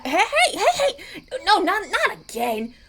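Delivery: high, loud, laughing hey hey; laughing protest. Acted. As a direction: squeal through laughter at being carried. Being picked up and carried.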